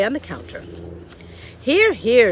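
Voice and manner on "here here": loud, bossy cook. Acted. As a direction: order her about. Cook's voice in the story.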